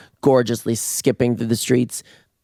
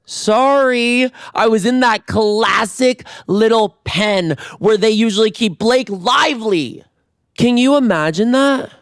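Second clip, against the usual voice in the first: mock fancy; 9 semitones higher than usual. Bragging about the VIP section.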